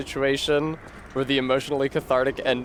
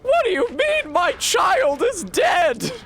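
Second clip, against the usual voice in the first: hammed-up, very high, drawn-out wail; mock shrieking; 4 dB louder, slower than usual. Off-screen character getting awful news in a horror trailer, mock shrieking. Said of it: "like a character just off screen shrieking".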